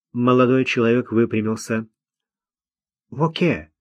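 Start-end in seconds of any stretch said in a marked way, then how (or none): 3.1-3.7 high-pitched, obliging answer; willing and compliant; eager to please. A narrator's reading. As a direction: answer readily, anxious to please. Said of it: "охотно ответил, явно желая угодить".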